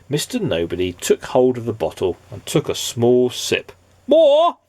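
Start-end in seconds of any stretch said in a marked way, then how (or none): 4.1-4.6 high, loud urging shout; wizard's cry. Acted. The wizard urging Mister Nobody to drink, loud high cry. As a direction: egg him on. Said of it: "cried the wizard".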